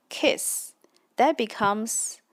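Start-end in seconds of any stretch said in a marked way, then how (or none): none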